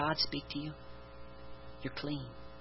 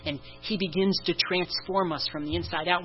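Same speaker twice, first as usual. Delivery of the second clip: normal talking voice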